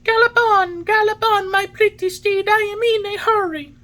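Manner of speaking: very high, loud, jubilant puppet voice. A narrator's reading. Pinocchio rides the pigeon's back, crying out gaily. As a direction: urge the steed on, high and gleeful. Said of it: "he cried out gaily".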